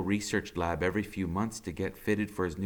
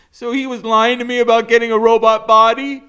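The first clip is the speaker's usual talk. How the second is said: high, very loud disbelief; upset outburst; 11 semitones higher, 16 dB louder than usual. Acted. Max questioning the doctor, high loud and hurt. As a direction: blurt it out, hurt.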